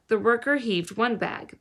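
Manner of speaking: usual speaking voice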